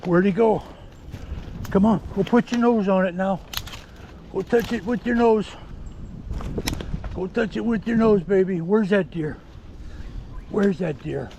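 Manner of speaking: high, eager coaxing to the dog; urging the dog on. Real, not acted. Handler urging his tracking dog toward the deer.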